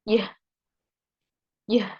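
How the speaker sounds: Y as a short bare glide sound; brief Y sound, repeated after a pause; Y sound demo. Shown as a demonstration. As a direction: model the sound of Y, not the letter name. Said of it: "Y sound".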